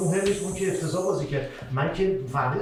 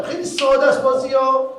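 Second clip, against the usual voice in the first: higher and louder, hammering ساده; emphatic insistence; 10 semitones higher, 9 dB louder than usual. Real, not acted. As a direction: insist the game is obvious. Insisting game is simple. (تأکید بلند روی «ساده»)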